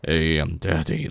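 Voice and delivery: low, slow mock daddy voice; slow, soft and deep parody of a roleplayer; roleplay streamer. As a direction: lightly put on a sultry roleplay voice. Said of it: "those roleplay ones, be like".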